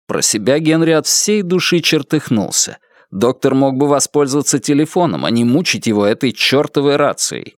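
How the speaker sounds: plain reading voice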